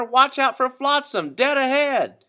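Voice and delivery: slow, very high, loud warning; warning shout. Acted. Owner warning Stewart from shore, slow high shout. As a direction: stretch the warning out across the pond.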